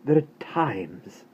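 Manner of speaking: slow, seething mother; between her teeth. Acted. Mother scolds her muddy son, slow and seething through teeth. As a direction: hold back the anger through the teeth. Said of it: "she said between her teeth".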